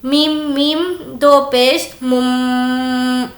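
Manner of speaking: very slow میم میم دو پیش مم with the مم held in the nose; nasal ghunna. Shown as a demonstration. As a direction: hold مم as a long nasal hum. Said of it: "یعنی ناک میں آواز کو روکیں گے". (ناک میں آواز روک کر غنہ)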